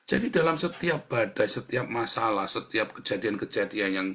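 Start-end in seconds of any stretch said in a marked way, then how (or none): none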